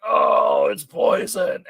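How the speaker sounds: hammed-up cry of poisoning; mock horror. Pizza lover imagining a bite of kale, mock horror.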